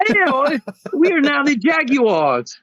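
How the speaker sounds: slow, high put-on British accent; mock British. Joking they came back from London, slow mock British accent. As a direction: stretch it out like a posh Brit. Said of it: "come back with British accents".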